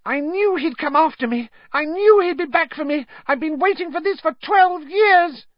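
very high squealing voice of a cornered coward; Pettigrew, panicked. A narrator's reading. Pettigrew voiced in story narration.

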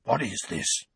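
hoarsely stunned uncle. Acted. Uncle baffled by the burning letter, asking hoarsely. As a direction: ask it hoarsely, in shock. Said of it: "Uncle Vernon said hoarsely".

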